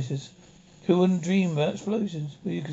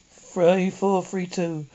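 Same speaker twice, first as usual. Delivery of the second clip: digits drawn out slowly and evenly; slowly reading digits aloud; slow number dictation; much slower than usual. Acted. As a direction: spell out each number with care.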